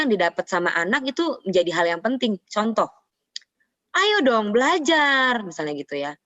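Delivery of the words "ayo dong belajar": raised, drawn-out parental urging; high intonation. Shown as a demonstration. Parent pushing a child to study, high intonation. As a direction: urge the child in a high, slow pitch. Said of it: "intonasi tuh tinggi".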